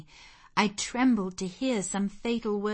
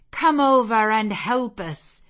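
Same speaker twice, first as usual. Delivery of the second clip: loud summons; 3 semitones higher, 10 dB louder than usual. A narrator's reading. Heavenly summons in vision.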